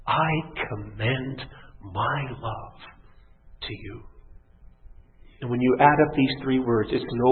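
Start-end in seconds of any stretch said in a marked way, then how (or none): 0.1-4.0 hushed, solemn tenderness; tender divine declaration. Acted. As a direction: say it gently, spacing out the phrases. Voices God offering love tenderly.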